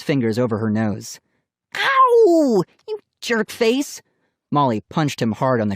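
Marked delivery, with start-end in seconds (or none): 1.7-2.6 sharp high yelp. A narrator's reading. Hair yanked, cries out. 2.9-4.0 angry insult. A narrator's reading. Girl insults cousin.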